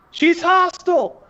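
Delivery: high, drawn-out alarmed yell. Acted. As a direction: yell a slow warning to the others. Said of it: "and then he goes to yell".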